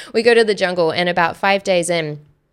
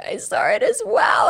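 high-pitched, hammed-up tearful wail; mock crying. She admits the shared vision to him, mock crying. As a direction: squeal it as if bursting into tears. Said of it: "I start crying".

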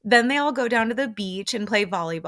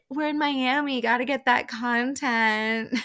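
imitating castmates; 5 semitones higher than usual. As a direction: parody their content catchphrase, pitched up and slowing. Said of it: "Like, they even said that".

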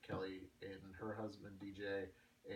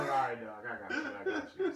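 laughing; 3 semitones higher, 8 dB louder, faster than usual. Retold or imitated. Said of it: "I was like".

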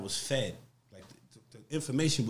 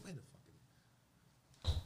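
hushed thought; 15 dB quieter, much faster than usual. Retold or imitated. Private thought, very low.

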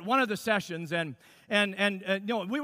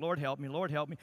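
murmured prayer; 7 semitones lower, 8 dB quieter than usual. Retold or imitated. Husband stuck on his love letter praying for help, soft pleading. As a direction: lower and softer, a pleading murmur. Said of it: "I'm over here praying".

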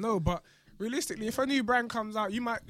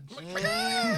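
high burst of laughter. Real, not acted. PFC joke lands.

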